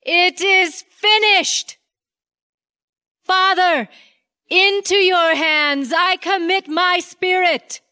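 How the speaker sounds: high, slow, triumphant last cry; loud final cry. Acted. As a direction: proclaim it slowly with your last breath. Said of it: "called out with a loud voice".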